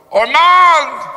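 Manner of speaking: high, loud holler of the name. Retold or imitated. Father hollering down to his son, loud high holler. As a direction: yell the name as the stone comes away. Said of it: "he hollered".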